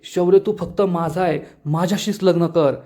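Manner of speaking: plain reading voice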